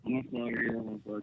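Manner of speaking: caller far from the phone; muffled, faint phone line. Real, not acted. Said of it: "it's muffled".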